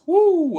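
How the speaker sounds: sudden high, loud whoo; loud whoop. Real, not acted. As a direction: let out a big whooping yelp. Hot takes would shock people.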